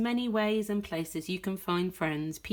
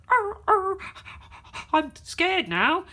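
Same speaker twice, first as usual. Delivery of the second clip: higher and louder, whimpering; scared dog; 11 semitones higher, 5 dB louder than usual. Retold or imitated. Reader voicing scared dog Slim, higher whimpering. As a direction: whimper like a cowed bully. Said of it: "it's like".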